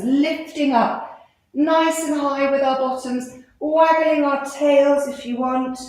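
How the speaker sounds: ordinary talk, nothing marked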